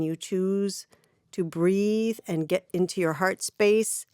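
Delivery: calm channeled message with long pauses; guided meditation. Acted. Delivers guided spiritual message.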